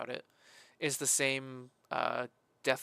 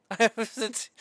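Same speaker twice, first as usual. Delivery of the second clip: laughing; 8 semitones higher, 7 dB louder, faster than usual. Real, not acted. Laughs mid-rant.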